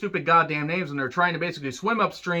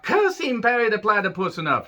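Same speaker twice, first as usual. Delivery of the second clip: Doofenshmirtz impression; 5 semitones higher than usual. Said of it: "terrible impressions".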